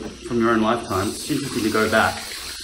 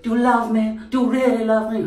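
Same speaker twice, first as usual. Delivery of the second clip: put-on high, pleading old-film heroine; olden-days movie accent; 10 semitones higher, 4 dB louder than usual. Woman in old film to her man, olden-days pleading. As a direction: play the melodramatic old-movie sweetheart, high. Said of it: "that olden days sort of accent".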